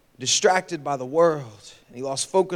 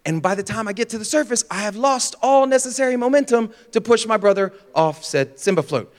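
normal talking voice

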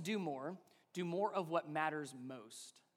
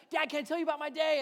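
higher, fast, lightly voiced eager child; pleading kid; 8 semitones higher, 6 dB louder, faster than usual. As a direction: beg Dad like an eager kid. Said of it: "they're pleading to".

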